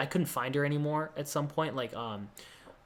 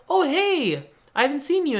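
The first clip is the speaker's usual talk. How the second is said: surprised hello; 11 semitones higher than usual. Retold or imitated. He answers her, startled.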